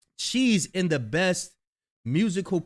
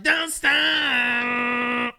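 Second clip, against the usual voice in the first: loud, high, drawn-out mock rapper holler; 8 semitones higher, 5 dB louder than usual. Host mocking a rapper onstage, hollered imitation. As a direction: stretch the last word out, high and loud. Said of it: "[name] talking about".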